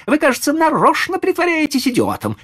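very high, exasperated lady; boiling over. A narrator's reading. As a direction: lose patience in a high-pitched voice. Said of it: "вскипела дама".

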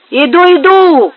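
high, loud, drawn-out call back; shouting back. A narrator's reading. Benito calls back to his comrades, loud high shout. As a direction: holler it across to the workers. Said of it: "закричал он потом товарищам".

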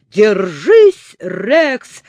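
loud, urgent call; shouting. Acted. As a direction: yell it across the pier. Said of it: "закричал Незнайка". (громкий крик)

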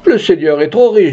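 high-pitched complaint; lightly acted plaintive villager; aggrieved peasant. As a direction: grumble upward to someone in power.

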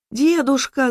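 high-pitched alarmed call; girl's cry. A narrator's reading. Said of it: "закричала она".

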